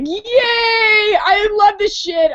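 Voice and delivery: dog voice yelling with pure delight; ecstatic dog voice. Retold or imitated. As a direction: bellow it like an overjoyed dog. Dog's joy voiced in story.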